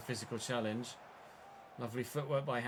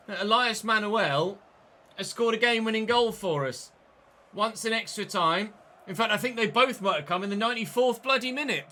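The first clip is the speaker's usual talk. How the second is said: higher and louder, buzzing with joy; excited praise; 7 semitones higher, 7 dB louder than usual. Real, not acted. Gamer excitedly telling viewers about the match winner. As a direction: gush about the hero. Celebrating winning goal.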